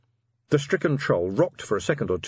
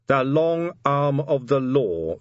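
slow, higher headline reading; reading aloud; 6 semitones higher, 4 dB louder, much slower than usual. A narrator's reading. Commander reads the newspaper headline aloud, slowly. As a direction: read the headline out slowly, word by word. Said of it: "Vimes read aloud".

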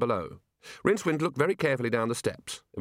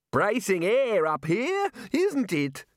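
high, drawn-out hearty character voice; hearty Arch-Chancellor; 10 semitones higher, much slower than usual. Acted. Arch-Chancellor to Rincewind atop the tower, hearty and high. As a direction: relish the air, stretching the words upward.

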